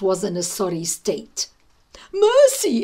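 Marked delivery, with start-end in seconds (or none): 2.1-2.8 high, loud desperate cry for mercy; sobbing plea. Acted. Wounded cat fleeing the arrows, high desperate cry. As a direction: beg for your life. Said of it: "it sobbed".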